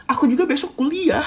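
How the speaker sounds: high-pitched mimicry of a partner; argumentative retort. Partner countering that they have class tomorrow too, argumentative.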